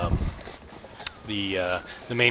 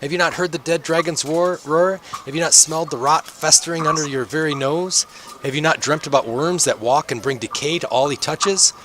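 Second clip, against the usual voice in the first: high, strained street-prophet voice; ranting prophet, pitched well above normal; shouting doomsayer; 6 semitones higher than usual. Acted. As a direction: proclaim doom to an indifferent crowd. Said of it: "sounds like he's kinda hoarse".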